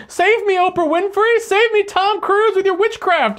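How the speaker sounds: high, pleading cry for saving; mock desperate prayer. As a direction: beg the celebrities to save you. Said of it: "you're praying to various deities".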